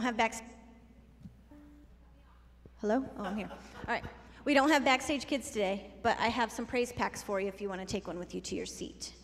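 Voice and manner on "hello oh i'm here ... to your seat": normal talking voice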